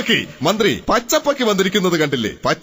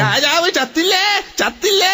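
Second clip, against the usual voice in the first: mock shout; 11 semitones higher than usual. King wakes, shouting alive.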